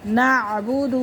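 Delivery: normal talking voice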